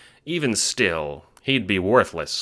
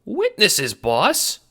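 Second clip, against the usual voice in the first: much higher squeaky question; 4 dB louder, much slower than usual. Acted. Henchman squeaking surprise at his boss's news. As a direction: squeak it back in alarm. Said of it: "Ace squeaks".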